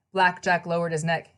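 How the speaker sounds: usual speaking voice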